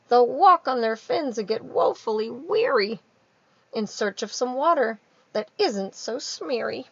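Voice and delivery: high, loud Lorax, mournful about the fish. Acted. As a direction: lament the fish's dreary future. Lorax voice in read-aloud.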